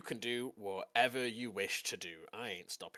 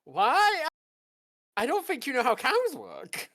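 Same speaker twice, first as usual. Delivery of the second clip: very high, loud put-on disbelief; incredulous outburst; 12 dB louder, slower than usual. Game master replaying his reaction to the cow mix-up, incredulous outburst. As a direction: squeal it loudly in mock bafflement. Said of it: "I was like".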